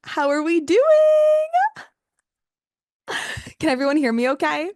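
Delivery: bright, high, loud greeting to the room; overly polite conference call. Real, not acted. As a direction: welcome everyone warmly and eagerly. Host greets a live call.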